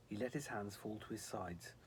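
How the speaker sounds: usual speaking voice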